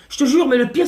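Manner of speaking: normal talking voice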